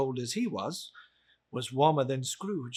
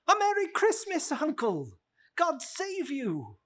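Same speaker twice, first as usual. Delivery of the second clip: higher, bright-spirited nephew greeting his uncle; cheerful nephew; 12 semitones higher, 3 dB louder than usual. Acted. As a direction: call out a Christmas greeting as the eager nephew. Narrator voices cheerful nephew.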